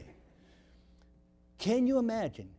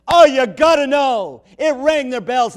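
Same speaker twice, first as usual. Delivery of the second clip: loud preaching; 6 semitones higher, 10 dB louder than usual. Acted. Preacher building to a shout.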